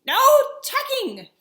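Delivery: high, loud chorus; crew shout. Acted. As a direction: crew yells it in unison. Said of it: "the crew cried".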